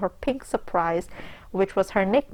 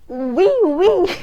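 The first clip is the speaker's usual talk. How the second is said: high, loud hammed-up French oui; French accent; 10 semitones higher, 8 dB louder than usual. A narrator's reading. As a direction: squeal it like a flirty French hostess. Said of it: "seductively alluring French accent".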